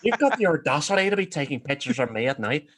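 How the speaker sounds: loud, affronted Northern Irish Bigfoot; Northern Irish accent. Acted. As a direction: scold loudly in put-on Northern Irish. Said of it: "he's, like, Northern Irish".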